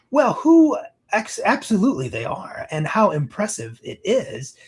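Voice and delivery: high, grand opening that settles down; flattered event planner. Acted. Event planner turning to the stranger praising her shoes, grandly flattered. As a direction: play a gushing woman in charge.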